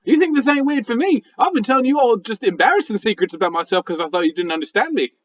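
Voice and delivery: high critter outburst easing into rambling; talking raccoon, flustered. Acted. Raccoon embarrassed by secrets told to friends, high and flustered. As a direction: protest high, then settle as you confess.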